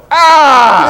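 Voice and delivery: high, loud pained "Ah!"; startled yelp. Retold or imitated. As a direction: yelp like a sound tech whose ears just got blasted. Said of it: "The audio person's going".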